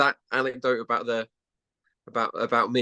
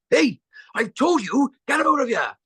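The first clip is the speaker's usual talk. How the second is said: imitated angry shout; 5 dB louder than usual. Runner spots snooper in pub, imitated shout. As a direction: shout like a runner ejecting someone. Runner throws intruder out, acted.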